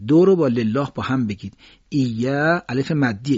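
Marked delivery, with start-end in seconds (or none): 1.9-2.6 ایا with long held a; leaning on alef. Shown as a demonstration. Teacher showing where voice rests, long alef. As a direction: stretch and lean on the ya of ایا. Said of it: "اینجا صدا تکیه قرار بدید".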